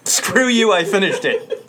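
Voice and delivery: loud mock indignant. Real, not acted. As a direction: shout it laughing.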